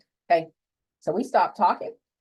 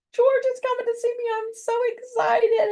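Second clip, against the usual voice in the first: very high, squealing with excitement; giddy excitement; 4 dB louder than usual. Retold or imitated. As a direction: gush like a thrilled teenager who can barely contain it. Telling friend about George's visit.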